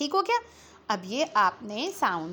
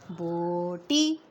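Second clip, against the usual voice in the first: बोटी drawn out slowly, both vowels held; sounding out; 4 semitones lower, much slower than usual. Shown as a demonstration. Teacher joining letter sounds for pupils, बोटी sounded out slowly. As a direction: stretch बोटी into two long, lower syllables. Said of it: "join करना और sound निकालना".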